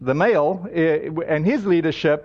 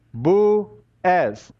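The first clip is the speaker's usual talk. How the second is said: "Boaz" drawn out slowly and a little irritated. Retold or imitated. Israeli man repeats his name slowly to the pastor, mildly irritated. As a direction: spell out "Boaz" slowly for someone who didn't get it.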